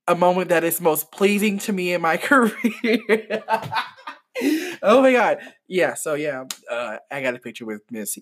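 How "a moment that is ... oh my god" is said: mock-solemn line cracking into a laugh; giddy laugh taking over the words; breaking into laughter. Real, not acted. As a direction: start earnest, then burst out laughing. Breaking up over own gushing.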